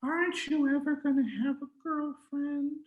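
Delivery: high, nagging mother's voice; mother nagging her son about girlfriends, high pitched; complaining mother. Acted. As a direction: needle him about his love life. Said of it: "other things to complain about".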